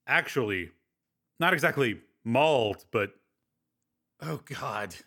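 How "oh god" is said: soft pained groan. Acted. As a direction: groan it out, hurting. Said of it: "I groaned".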